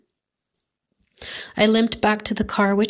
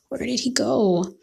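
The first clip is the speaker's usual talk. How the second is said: usual speaking voice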